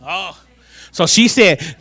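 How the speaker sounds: ordinary talk, nothing marked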